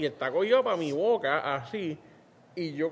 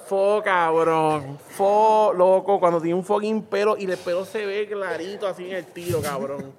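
louder, drawn-out "Fo" of disgust; disgusted loud groan; 5 dB louder, slower than usual. Retold or imitated. Reacting to the hair on the heel, loud and disgusted. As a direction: groan at the gross sight.

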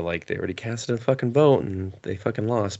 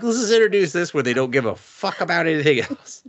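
mocking; 9 semitones higher, 3 dB louder than usual. Mocking school curriculum makers.

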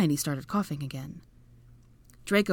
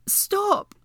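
very high, urgent yelp; pained cry; 4 dB louder than usual. A narrator's reading. Hermione shoved into the stone, pained high cry. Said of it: "Hermione grunted in pain".

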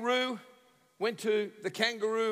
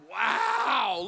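drawn-out amazed wow, louder and higher; gawping, stretched-out wow; 4 semitones higher, 5 dB louder than usual. Retold or imitated. Salesman eyeing an easy customer, exaggerated amazement. Imagines salesman's reaction at him.

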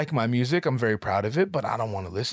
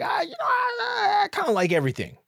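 squeaky, much higher whine; mock whiny self. Narrator mocking his own dodge to the host, whiny. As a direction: parody yourself as a pathetic whiner.

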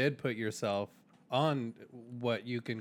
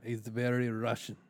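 put-on Russian accent. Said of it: "he talks like this".

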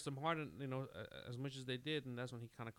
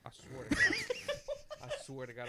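laughing; 5 dB louder than usual. Real, not acted. Laughing while retelling the encounter.

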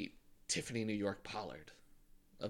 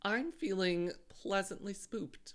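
slow, pleased; 8 semitones higher, 4 dB quieter, slower than usual. Pretend. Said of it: "does this year have you feeling spoopy".

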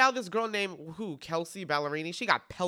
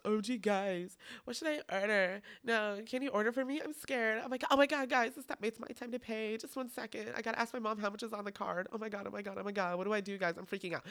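put-on higher, breathless nervous teen voice; panicky teen TikToker; 4 semitones higher, 4 dB quieter than usual. Mocking teens filming their coffee order, higher and panicky. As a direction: play a scared kid filming a Starbucks order. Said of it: "We've all seen the TikToks".

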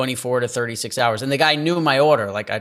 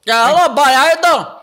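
loud, high, rushed phone greeting; diner phone guy impression; 11 semitones higher, 7 dB louder than usual. Diner guy picking up the phone, loud high impression. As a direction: bark it out high like an impatient order taker. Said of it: "I won't do the impression".

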